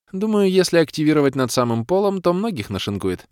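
put-on a keen young mage showing off her scroll; young woman's voice.

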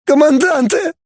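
very high falsetto wail; squealed confession in pain; pained howl. A narrator's reading. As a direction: wail the answer under torture. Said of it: "взвыл лже-Фанас".

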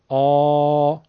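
آ held loud and full in the throat; a strong, heavy throat آ; full heavy vowel. Shown as a demonstration. (آ با صدای بلند، پرحجم و مفخم)

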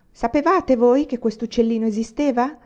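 ordinary delivery, nothing marked

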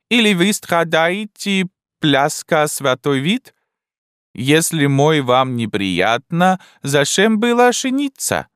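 put-on high, slow, broken-Russian old Frenchwoman; a bewildered elderly French wife, high and slow; old Frenchwoman puzzled by her husband; old French lady. Old French lady's lines.

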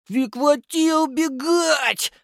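put-on high, slow, breathless child voice; huffing through a mouthguard; puffing fat kid. A narrator's reading. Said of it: "пропыхтел толстяк".

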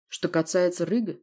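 ordinary talk, nothing marked

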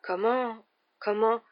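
stumbling, repeated stammer. Acted. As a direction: trip over the words in shock.

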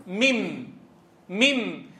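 "ميم" as "mimm", ending on a hummed m; noon turned meem. Shown as a demonstration. Teacher shows students what noon became, hummed meem. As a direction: close the lips and hum the last m of "ميم". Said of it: "النون الساكنة شو صارت؟".

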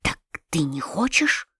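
hushed, intense whisper; a father whispering in frenzy; frenzied whisper. A narrator's reading. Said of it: "шептал он мне в каком-то исступлении".

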